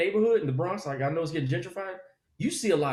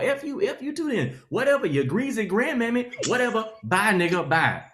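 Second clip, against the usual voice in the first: parents yelling; 6 semitones higher than usual. Retold or imitated. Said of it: "all they do is yell".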